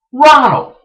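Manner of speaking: high, loud holler across a store; yelling a name. Retold or imitated. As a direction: call out to someone far off. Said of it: "he's yelling Ronald's name".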